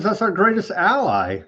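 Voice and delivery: ordinary talk, nothing marked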